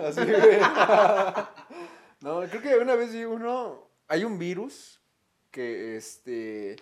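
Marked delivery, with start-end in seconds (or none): 0.6-1.9 loud laugh. Real, not acted. Laughing at own joke.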